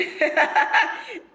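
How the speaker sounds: bright high giggle. Real, not acted. Laughs about waistline.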